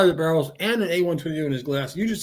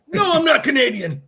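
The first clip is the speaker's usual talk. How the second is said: high, loud indignant denial; pitched-up, forceful mock objection; mock protest; 10 semitones higher, 5 dB louder than usual. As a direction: imitate a bartender protesting at being teased. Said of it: "He was like".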